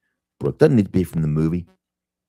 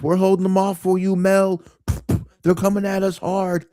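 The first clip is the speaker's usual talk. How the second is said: much higher, louder voice; high mock shout; 13 semitones higher, 4 dB louder than usual. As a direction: call out like a fake gunfight. Acted quote of henchmen.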